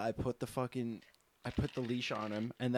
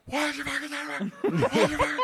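much higher, louder angry shout; hammed-up yell at the dog owner; mock furious yell; 9 dB louder, faster than usual. Mimicking the angry man yelling at Frankie, high and loud. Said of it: "and he's like".

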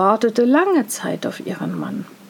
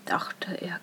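ordinary delivery, nothing marked

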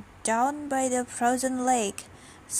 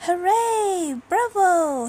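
high, drawn-out storybook cheer; jubilant, raised-pitch applause call; 7 semitones higher, 5 dB louder, slower than usual. A narrator's reading. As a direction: cheer with voice swooping up. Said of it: "he cheered".

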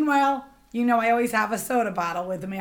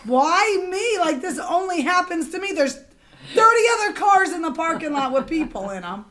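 high-pitched, louder 'why me' complaint; retold exasperated outburst; 7 semitones higher, 5 dB louder than usual. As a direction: protest your bad luck, pitched up. Retells exasperated reaction to being singled out.

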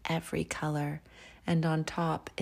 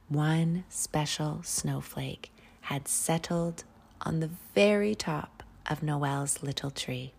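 usual speaking voice

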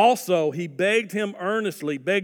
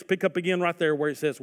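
ordinary delivery

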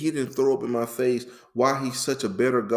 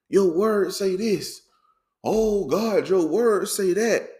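higher-pitched pleading protest; aggrieved complaint; 6 semitones higher than usual. Retold or imitated. Complaining to the Lord about his word, aggrieved. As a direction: argue your case to heaven. Retelling an angry complaint to God.

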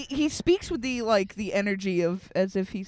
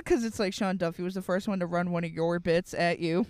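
ordinary delivery

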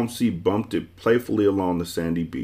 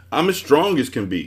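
mild, higher-pitched roar; a boast with a touch of roar; 7 semitones higher, 4 dB louder than usual. A narrator's reading. Man shows off his strength, understated roar. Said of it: "he roared".

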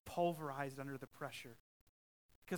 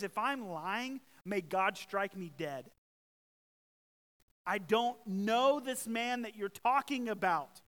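louder, slow desperate oath; Peter's oath; 4 semitones higher, 7 dB louder, slower than usual. Retold or imitated. Peter swears a curse to deny Jesus, slow and loud. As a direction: swear it like a cornered man. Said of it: "he basically says".

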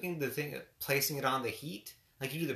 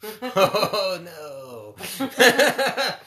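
laughing; 7 semitones higher, 11 dB louder than usual. Real, not acted. Laughing at partner's joke.

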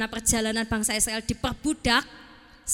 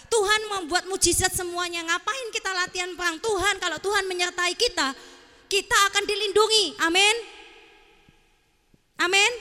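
preaching Joshua; 6 semitones higher than usual. Acted. Sulky Joshua tells Moses God will protect them, preaching in protest. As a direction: preach it out with confident Amens. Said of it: "Yosua berkhotbah gitu ya".

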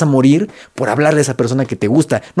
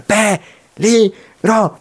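stretched, very slow, high "peligro"; don Ramón impression; 7 semitones higher, much slower than usual. Quoting a TV character to viewers, slow drawn-out warning. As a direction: draw out "peligro" like the sitcom character. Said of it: "Así como don Ramón decía".

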